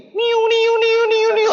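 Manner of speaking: high siren wail imitated; ambulance siren. (menirukan sirene ambulans)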